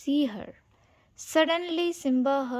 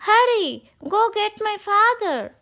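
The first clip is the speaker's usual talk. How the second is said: higher and louder, urgent command; urgent order; 10 semitones higher, 7 dB louder than usual. Acted. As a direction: order the bird off in a hurry. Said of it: "Simba ordered Zazu".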